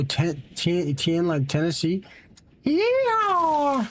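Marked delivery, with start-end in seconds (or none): 2.6-3.9 a sudden high, excited yelp; excited yell. Real, not acted. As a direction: jump up with a burst of excitement. Excited reply about Tennessee.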